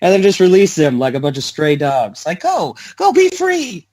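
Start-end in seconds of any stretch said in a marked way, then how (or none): 2.4-3.9 high, theatrical send-off; mock grand release. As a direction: shoo them off grandly, high pitched. Mock release of stray dogs.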